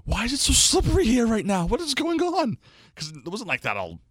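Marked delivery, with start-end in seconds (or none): 0.1-2.6 high-pitched mock wail of bewilderment; face-down complaint. Man face down on the slippery deck, hammed-up wailing. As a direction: whine it like a fallen man. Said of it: "face down on the deck, like".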